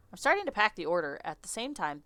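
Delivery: normal talking voice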